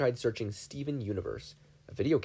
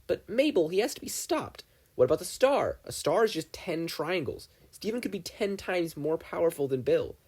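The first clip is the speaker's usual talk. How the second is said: put-on higher, urgent boyish voice; Dipper voice; 6 semitones higher than usual. Dipper urges Mabel to stop Steven, higher urgent boy voice. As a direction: push the point on Mabel, higher-pitched.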